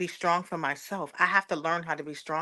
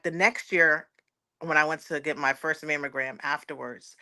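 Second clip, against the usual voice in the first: ordinary talk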